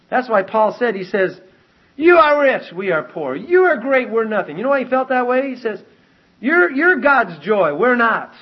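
loud, high, full-force sermon delivery; impassioned preaching. Acted. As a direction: preach Paul's contrast with rising fervour, loud and high. Preaching Paul's words at full force.

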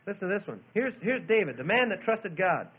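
ordinary delivery

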